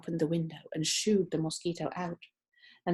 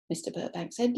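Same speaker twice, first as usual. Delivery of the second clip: plain reading voice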